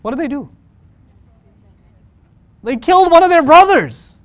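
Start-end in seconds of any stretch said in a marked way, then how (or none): 2.6-4.2 much louder and higher punchline; dramatic reveal. Real, not acted. Preacher revealing the shock, loud and high. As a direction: hit the answer hard. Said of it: "dramatic reveal".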